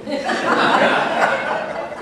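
high, loud burst of laughter; real laughter. Real, not acted. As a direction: let the laugh out fully. Laughing at kids' reply.

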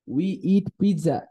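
slow, careful English model line; slow teaching read. Shown as a demonstration. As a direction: read it slowly for the class. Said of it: "let's read".

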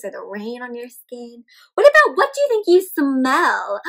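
usual speaking voice

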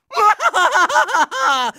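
hammed-up triumphant cackle; long gloating villain laugh; evil villain laugh. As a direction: cackle like a cartoon bad guy.

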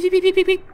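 high beeping like game dialogue blips; imitating the game's talk sounds; imitated text beeps. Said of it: "You know what the beep".

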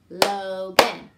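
loud "Logan" in two beats; clapped syllables. Shown as a demonstration. Teacher clapping a staff name with pupils. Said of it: "Ready? Go".

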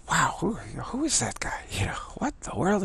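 hushed, breathy whisper of wonder; awed whisper. Retold or imitated. Tibetans watching the California guy, awed whisper. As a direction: marvel under your breath.

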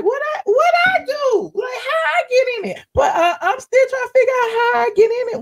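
high-pitched, whining protest of disbelief; mock wail. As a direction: wail it in exaggerated bewilderment. Mock-wailing her baffled reaction.

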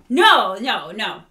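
normal talking voice

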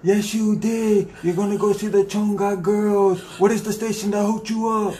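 high, loud excited announcing. Acted. DJ announces winner.

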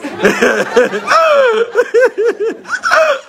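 long high laugh; hearty laugh. Real, not acted. Priest cracking up at his own joke, hearty laugh. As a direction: let the laugh roll out.

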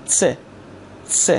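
س as a quick "tse"; T and S together. Shown as a demonstration. Teacher asking learners to repeat, س sounded "tse". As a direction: say س with t run straight into s. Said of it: "ت و س رو سعی کنید به دنبال هم سریع".

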